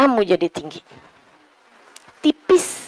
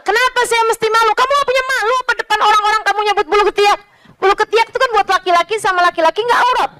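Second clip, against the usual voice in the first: higher, fast indignant comeback; defiant retort; 9 semitones higher, much faster than usual. Retold or imitated. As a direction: fire back quickly, pitched up. Sheikh defending himself, retold.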